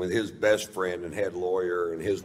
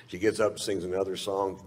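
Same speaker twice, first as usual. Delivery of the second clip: normal talking voice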